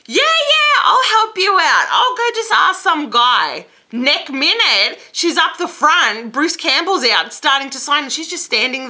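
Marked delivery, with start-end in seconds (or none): 0.1-3.7 high, loud mock-helpful voice; squeaky-high, exaggerated yeah-sure brush-off; over-eager helper. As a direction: mimic an over-keen helper, pitched way up. Acted glib offer of help.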